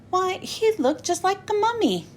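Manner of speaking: ordinary delivery, nothing marked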